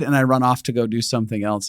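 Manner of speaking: ordinary talk, nothing marked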